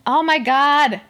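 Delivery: loud, high burst of exclamation; exclaiming. Acted. Podcaster reacting to the show mid-sentence, exclaiming loudly. Sudden reaction, cuts herself off.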